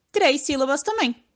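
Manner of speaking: normal talking voice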